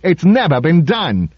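indignant protest, pitched high; high-pitched outrage at a broken rule. A narrator's reading. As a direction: object in a high, affronted voice. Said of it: "raised an indignant voice".

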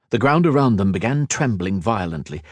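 ordinary delivery

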